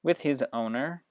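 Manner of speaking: put-on animated read-aloud for young students; storytelling to children. Shown as a demonstration. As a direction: tell it big and slow so the app can follow. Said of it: "on the app tap to get things started".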